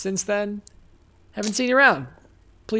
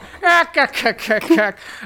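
high, loud staccato cartoon cackle; hammed-up cartoon sailor chuckle; Popeye laugh; 8 semitones higher, 7 dB louder than usual. Shown as a demonstration. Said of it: "an impression of Popeye".

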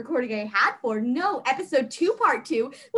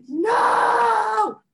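loud, high mock wail; 8 semitones higher, 5 dB louder than usual. Answering a worried roommate, melodramatic wail. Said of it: "just being like".

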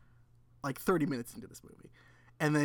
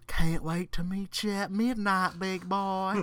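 Southern belle on an answering machine; Southern accent; 3 semitones higher, slower than usual. Retold or imitated. Host imitating a woman's voicemail for co-host, Southern accent. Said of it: "a woman with a southern accent".

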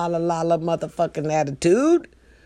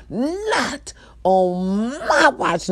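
slow, higher, melodramatic catchphrase; not-on-my-watch lady; 5 semitones higher, much slower than usual. Acted. Manager seeing the huddle, recalls the watch lady, drawn-out dramatic cry. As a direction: stretch it out like a theatrical cry. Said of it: "That's what came to my mind".

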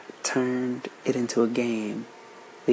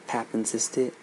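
usual speaking voice

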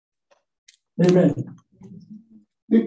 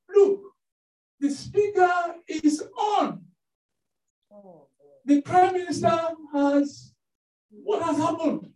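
alarmed warning; 7 semitones higher than usual. Retold or imitated. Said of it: "called the P A and said".

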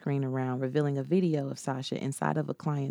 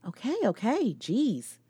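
flustered; 8 semitones higher, slower than usual. Acted. Sasha flustered by panicked friend.

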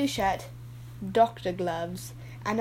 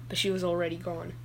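ordinary talk, nothing marked

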